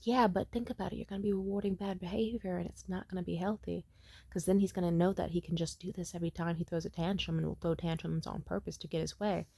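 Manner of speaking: discreet reasoning. Retold or imitated. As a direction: reason with her under your breath.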